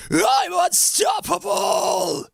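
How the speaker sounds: high, slow screamed boast; full metal scream. Retold or imitated. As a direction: go all out like a metal singer. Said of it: "I just wanna go, like, full".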